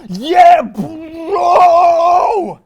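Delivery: high, loud, drawn-out celebration; triumphant roar. Real, not acted. Host celebrating twenty comments, long high roar. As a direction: roar it and hold the last word.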